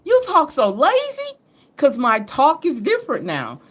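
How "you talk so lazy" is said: high, astonished mock friend; shocked northern friend. Friend up north shocked by her lazy talk, high and astonished. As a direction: gasp it out like a stunned old friend. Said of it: "they're like".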